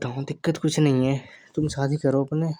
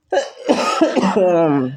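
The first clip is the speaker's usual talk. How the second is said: loud cough; 2 semitones higher, 5 dB louder than usual. Acted. Loud cough mid-argument.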